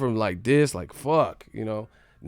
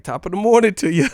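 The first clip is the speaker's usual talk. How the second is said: high, loud chirpy servant greeting; hammed-up cheerful hello; mock cheery greeting; 9 semitones higher, 12 dB louder than usual. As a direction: sing it out like an overeager servant. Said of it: "He raised, like".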